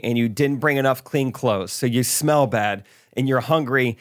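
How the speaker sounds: ordinary delivery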